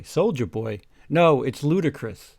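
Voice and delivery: ordinary delivery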